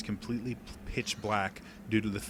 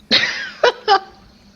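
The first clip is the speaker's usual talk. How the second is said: high, loud creature cackle; monster cackle; 16 dB louder than usual. Acted. Creature laughs at the player.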